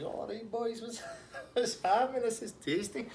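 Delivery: very high, comic put-on voice; chilled-out chancer. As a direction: imitate him blithely greeting the police, laughter creeping in. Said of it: "just chilling out".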